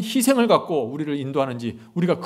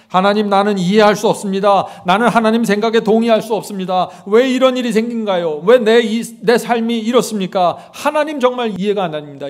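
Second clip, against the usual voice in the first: loud, higher complaint to God; mock complaint of a doubting believer; complaining believer; 6 semitones higher, 8 dB louder than usual. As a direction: protest to God in frustration. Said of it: "이렇게 불평하죠".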